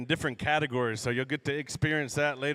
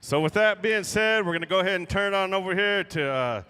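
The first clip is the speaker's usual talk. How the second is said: powwow emcee; 3 semitones higher, 3 dB louder than usual. Real, not acted. Emcee hands over to singers.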